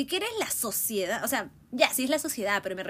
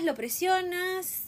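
lo presionas stretched slowly, still high; slow drawn-out repeat; 9 semitones higher, much slower than usual. Real, not acted. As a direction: draw out lo presionas slowly, letting it sink in. Building complaint about pressure. (lo presionas, lento y alargado)

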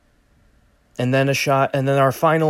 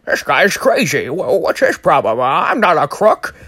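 higher, louder mock outrage; indignant Batman; 6 semitones higher, 6 dB louder than usual. Batman chasing Oz, huffy and loud. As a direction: play it as a huffy hero. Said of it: "and he says".

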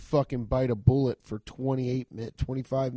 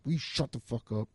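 angry whisper; 4 semitones lower, 7 dB quieter than usual. Retold or imitated. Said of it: "angry whisper".

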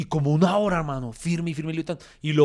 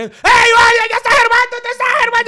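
high, loud excited mob voice; imitated fans yelling; 11 dB louder than usual. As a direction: shriek it like fans mobbing someone. Fans yelling at him, retold. (gritos agudos imitando a los fanáticos)

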